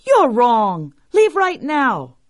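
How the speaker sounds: high, forceful command; stern order. Acted. Farmer ordering tokebi off, high angry command. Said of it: "ordered the farmer".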